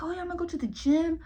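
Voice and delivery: fast, high, breezy boast; mock overeager resolution; overconfident goal-setter. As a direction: brag it like you will surely manage it. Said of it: "be like".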